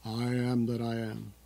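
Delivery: a hint of a deep, booming movie God voice; mild imitation of a grand, solemn screen God; Charlton Heston's God. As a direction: lightly intone it like the voice of God. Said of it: "Charlton Heston does the voice of God".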